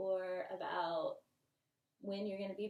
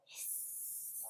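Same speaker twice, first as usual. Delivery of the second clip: ordinary delivery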